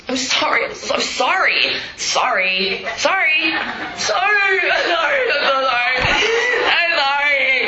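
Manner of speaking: rising to a high, slow, wailing "I'm sorry"; mock whiny apology. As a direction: ham up an endless begging apology. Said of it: "they've been hearing "I'm sorry" for years".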